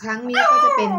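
very high, loud opening; excited. Pretend. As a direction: belt the intro out high. Very high, loud sung-out start.

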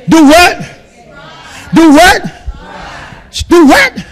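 loud rising call to the crowd; call and response. Acted. Pastor calling out to the congregation, loud and rising. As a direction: demand the answer from the pews.

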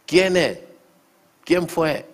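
high, loud demand for a name; urgent questioning. Retold or imitated. Pastor acts out questioning the member. (interrogando con voz alta y aguda)

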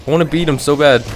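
high, loud, frantic; scared and keyed up; panicking gamer. Real, not acted. Running in circles, scared.

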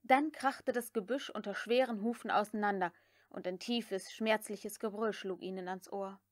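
ordinary delivery

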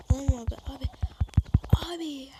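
loud, high excited yell; thrilled yell at the screen; excited shout. Real, not acted. Gamer excited mid-fight.

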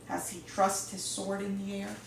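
ordinary delivery

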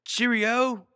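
high, hammed-up British accent. Host keeps up the British bit, a very high hammy British accent. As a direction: sing it out like a posh caricature in a British accent. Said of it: "Show me your British accent".

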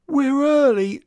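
coy, scheming simper. A narrator's reading. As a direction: sweet-talk them, a little too sweetly. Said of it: "simpered crafty Chick".

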